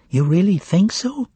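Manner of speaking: high, uneasy question, still voiced; mildly anxious whisper. A narrator's reading. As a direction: ask anxiously with a high, rising tune. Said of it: "whispered Berlioz anxiously".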